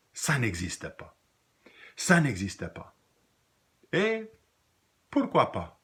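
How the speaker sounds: deliberate refrain with long pauses; poem recitation. Acted. Teacher ending the ant poem for students, dramatic recitation. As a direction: let each line stand alone, then lift the closing question.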